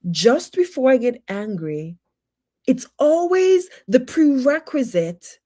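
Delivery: normal talking voice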